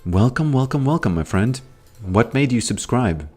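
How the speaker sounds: ordinary talk, nothing marked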